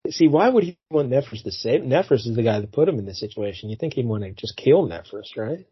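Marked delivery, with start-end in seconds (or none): none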